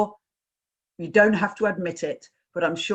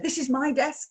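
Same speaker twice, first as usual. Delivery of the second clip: higher, chirpy colleague's quote; chirpy showing-off; 7 semitones higher, faster than usual. Retold or imitated. Colleague showing someone round her desk, chirpy. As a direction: show it off brightly, pitched up.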